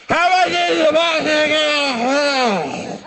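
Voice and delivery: put-on slurred, drawn-out high drunken holler; drunken gibberish. Drunk women boarding a bus, slurred high holler. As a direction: yowl it as unintelligible drunk babble. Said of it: "speaking a strange drunken language".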